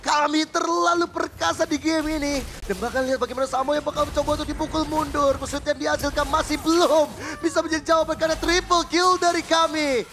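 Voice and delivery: rising hype play-by-play call; fighting game announcer. Real, not acted. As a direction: build the call up like a knockout. Caster calls the teamfight live.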